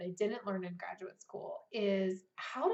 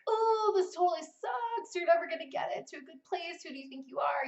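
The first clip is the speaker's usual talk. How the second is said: sneering critic, pitched up; mock the harsh inner voice; mean inner critic voice; 7 semitones higher than usual. Writer voicing her inner critic's sneer, mocking. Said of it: "there's voices like".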